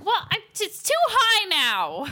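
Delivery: high, flustered granny voice. Real, not acted. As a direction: sound like a flustered grandma. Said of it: "You sound like an old lady".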